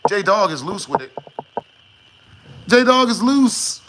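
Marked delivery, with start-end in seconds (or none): 2.7-3.8 slow loud announcement; shouting. Real, not acted. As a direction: belt it out slowly. Shouting the joke.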